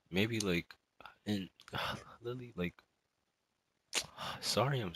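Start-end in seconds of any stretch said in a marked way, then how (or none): 1.5-2.2 heavy sigh. Real, not acted. Heavy sigh mid-confession.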